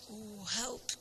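soft, mock-distressed wail; understated groan of alarm; moaning. A narrator's reading. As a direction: moan for help in a weak voice. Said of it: "kermde de dames groen".

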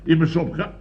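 normal talking voice

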